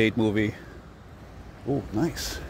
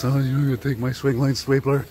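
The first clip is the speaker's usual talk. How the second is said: Milton from Office Space; 4 dB louder than usual. Retold or imitated. Vlogger imitating the movie character Milton for viewers, timid pleading. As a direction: plead meekly like a downtrodden clerk. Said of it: "he talks like this".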